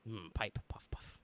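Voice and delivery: unvoiced whispered puff puff; whispered pipe puffs. Miming pipe smoking.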